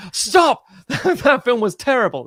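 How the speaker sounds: high, loud shout, laughing; shouted stop. Retold or imitated. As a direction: yell stop then complain.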